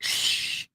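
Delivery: sharp unvoiced crowd shush. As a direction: hush someone like an annoyed crowd. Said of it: "the multitude was like".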